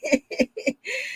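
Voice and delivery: high, soft giggling laugh; amused laughter. Real, not acted. Vlogger cracks up over shoulder pads, amused laughter. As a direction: giggle away after the joke. Laughing at own outfit joke.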